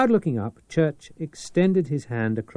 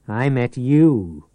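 leering; 2 semitones higher, 9 dB louder, slower than usual. Acted. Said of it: "the Peeper leered".

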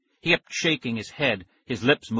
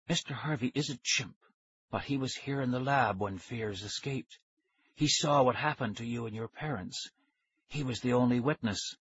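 hushed scientist; 7 dB quieter than usual. A narrator's reading. Scientist reveals witness to ghost children, hushed. Voices the scientist Doctor Smollett.

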